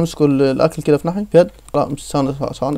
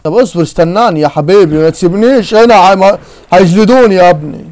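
loud, rising plea not to be left; panicky pleading; 3 semitones higher, 7 dB louder than usual. Real, not acted. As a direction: beg your friend, voice climbing and louder. Begs friend not to leave him. (استغاثة بصوت عالي وخايف)

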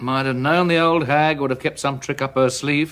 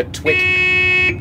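sudden yell; 4 dB louder than usual. Acted. Yelling at the cat.

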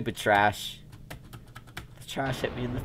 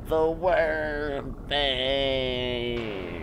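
drawn-out dismay; 5 semitones higher, 3 dB louder, much slower than usual. Pretend.

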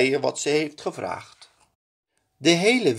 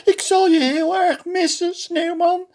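very high, louder crying voice; 5 dB louder than usual. A narrator's reading. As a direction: princess weeps for her friend. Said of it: "Prinses Titia begint te huilen". (huilend, hoog)

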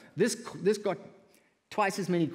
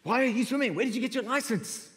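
mock caller; 10 semitones higher than usual. Caller quoted angrily.

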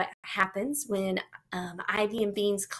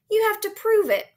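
high, louder demand; challenging; 13 semitones higher, 6 dB louder than usual. Acted. Child demands proof from a boasting classmate, challenging. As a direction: throw down a challenge, pitch right up. Vanessa challenges Bean's claim.

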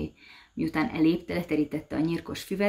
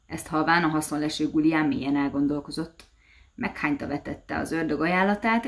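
usual speaking voice

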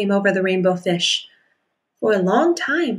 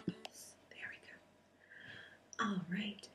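soft whispered aside; whispering; 27 dB quieter, slower than usual. Real, not acted. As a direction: murmur it under your breath.

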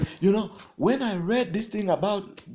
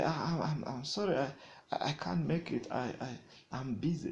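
stammering excuse; 8 dB quieter, faster than usual. Retold or imitated. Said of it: "you say".

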